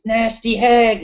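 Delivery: a scornful crowd's put-down; dismissive jeer. Retold or imitated. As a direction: toss it off like a rude heckler. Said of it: "everybody'd be like".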